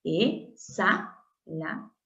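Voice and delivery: "Exhalación" slowly as e, xa, la, ción; slow, gapped sounding-out of "Exhalación"; syllable by syllable. Shown as a demonstration. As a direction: softly separate each syllable of "Exhalación". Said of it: "forman siempre una sola sílaba".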